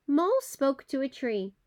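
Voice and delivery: plain reading voice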